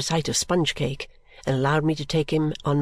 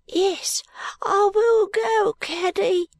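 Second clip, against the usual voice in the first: put-on much higher, slow little boy giving in; small boy crying out; much slower than usual. A narrator's reading. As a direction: give in like a small child, drawn out. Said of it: "cried Peepy".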